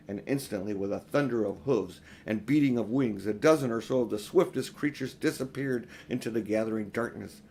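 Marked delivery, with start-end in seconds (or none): none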